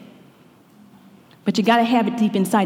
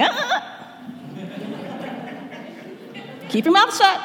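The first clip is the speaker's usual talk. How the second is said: high, scolding warning voice; hammed-up inner voice telling you to hush; Holy Spirit warning; 11 semitones higher, 4 dB quieter than usual. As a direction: stop yourself like a parent wagging a finger. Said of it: "that little thing is like".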